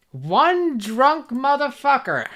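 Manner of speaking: meme quote. Retold or imitated. Quoting a meme line.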